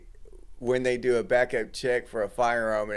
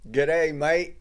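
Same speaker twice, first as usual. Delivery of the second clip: light mock Australian greeting, pitched up; playful hint of an Australian accent; 5 semitones higher, 3 dB louder than usual. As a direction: toss off a jokey Aussie hello. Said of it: "Y'all didn't know I could speak Australian".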